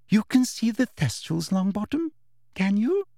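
high, sugary questioning; prim, high-pitched official voice; condescending inspector. A narrator's reading. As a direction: probe the pupil with false politeness. Umbridge figure questioning a pupil.

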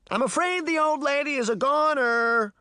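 very high singsong taunt. A narrator's reading. Boy to girls about old lady, taunting. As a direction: needle them in a high, bratty voice. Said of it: "Puck taunted".

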